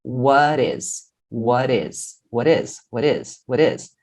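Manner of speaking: "What is" run together with the T as a soft tapped R; T linked as R. Shown as a demonstration. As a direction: say "what is" as one word, "wha-rez". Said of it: "Ele vai virar R entre o som de duas vogais". ("what is" emendado, com o T virando R)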